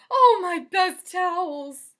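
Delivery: very high, loud, slow lament; dismayed wail. Acted. Missus Rogers lamenting her best towels, high loud slow wail.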